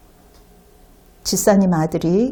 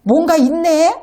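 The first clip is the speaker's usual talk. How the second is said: surprised realization; 6 semitones higher than usual. Retold or imitated. Son amazed by mother's prayer.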